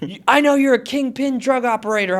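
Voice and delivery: high-pitched mock cop accusation; accusing cop. Storyteller as the cop accusing him, mock confrontational. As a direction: imitate an officer laying down the charge.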